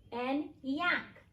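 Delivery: ordinary delivery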